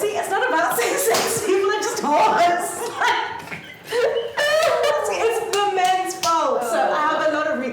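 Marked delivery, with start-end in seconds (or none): 0.0-6.6 high, laughing punchline. Real, not acted. Comic to friends, high laughing punchline. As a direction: say it through laughter.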